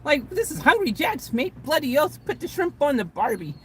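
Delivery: hammed-up Aussie. Aussie fans scoffing at the Burger King rename, hammed-up Australian accent. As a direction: play an outraged Aussie with a stock Australian accent. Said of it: "They're like".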